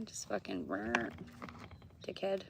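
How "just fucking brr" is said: mock bull noise. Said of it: "hear that angry bull out there".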